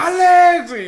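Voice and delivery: high, very loud name shout; shouted high summons; retold full shout. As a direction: bellow for the brother who won't respond. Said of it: "Yo le grité fuerte".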